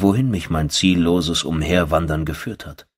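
plain reading voice

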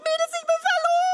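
squealed group-chat news; excited squeal. Pretend. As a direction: gush to the girls.

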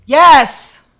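loud, high burst of recognition; real excited yell. Real, not acted. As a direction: yell it as the memory suddenly clicks. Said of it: "Sorry, I just yelled that".

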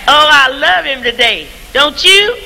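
normal talking voice

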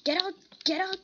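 sudden shout. Real, not acted. Player driving something away in the game, shouting. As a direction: shout it in two sharp bursts.

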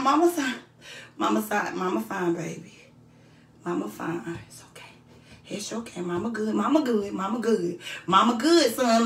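low hushed mama reassurance; soft mother soothing son. Pretend. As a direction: whisper comfort to a child. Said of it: "soothing mother role, whispered".

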